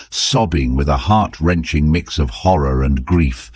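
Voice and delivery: ordinary delivery, nothing marked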